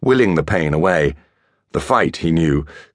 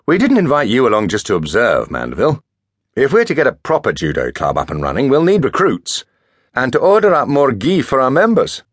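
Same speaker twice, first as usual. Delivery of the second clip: higher, louder teasing friend; chiding schoolboy; 7 semitones higher, 4 dB louder than usual. A narrator's reading. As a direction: prod him to join. Marcus chiding Mandeville, voiced.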